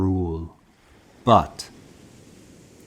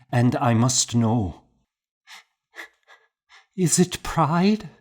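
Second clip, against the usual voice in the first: higher, louder, tearful pleading building to a cry; anguished pleading; 5 semitones higher, 5 dB louder than usual. A narrator's reading. Monk weeping at his friend's bedside, anguished and rising. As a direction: pour out the confession through tears.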